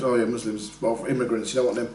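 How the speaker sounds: mock bigot. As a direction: mimic a casual bigot, muttered low. Mocking a bigot's reply.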